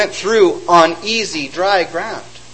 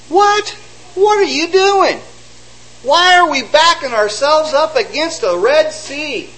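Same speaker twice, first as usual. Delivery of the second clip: high, shocked cry of "what"; much higher, incredulous panic; alarmed disbelief; 9 semitones higher than usual. Acted. Israelites questioning Moses at the sea, alarmed.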